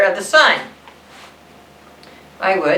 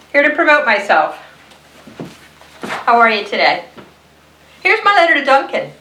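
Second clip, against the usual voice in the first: a higher, upbeat sales pitch; chirpy crayon; 10 semitones higher than usual. Acted. Pink Crayon promoting itself to the audience, higher and cheerful. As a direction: sell yourself brightly, lightly voiced.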